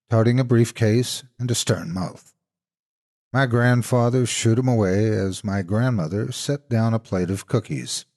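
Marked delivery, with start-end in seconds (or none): none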